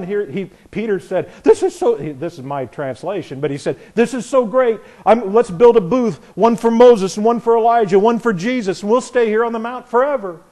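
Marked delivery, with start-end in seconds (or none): 1.4-2.0 excited Peter. Retold or imitated. 3.9-10.4 gushing Peter. Retold or imitated.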